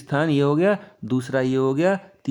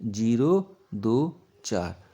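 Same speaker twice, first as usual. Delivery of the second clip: slow dictation; 3 semitones lower, much slower than usual. Shown as a demonstration. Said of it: "यही तो हमारा प्रश्न है".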